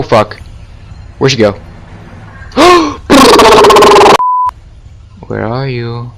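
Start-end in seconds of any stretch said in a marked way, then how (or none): 2.4-4.5 high startled gasp; a real frightened intake of breath; sharp gasp. Real, not acted. Scared by game monster.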